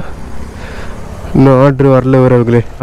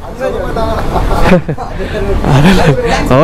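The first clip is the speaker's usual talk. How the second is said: laughing; 4 semitones higher than usual. Real, not acted. Laughs after telling home area.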